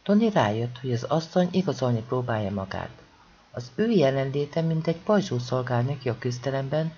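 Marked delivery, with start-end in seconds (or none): none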